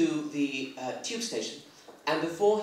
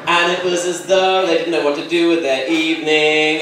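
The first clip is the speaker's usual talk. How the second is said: normal talking voice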